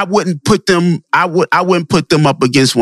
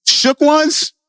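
incredulous; 12 semitones higher than usual. Real, not acted. Incredulous reply to rap claim.

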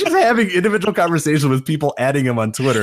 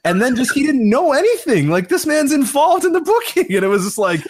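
ordinary talk

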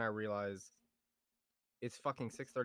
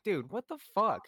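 exasperated; 8 semitones higher, 5 dB louder than usual. Retold or imitated. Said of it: "I'm like".

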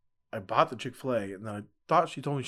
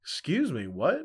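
retold indignation; 6 semitones higher, 4 dB louder, slower than usual. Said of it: "we were, like, yelling at each other".

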